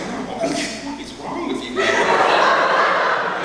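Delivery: laughing, high-pitched mock scold. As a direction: tease him through a laugh. Florist lady laughing at him.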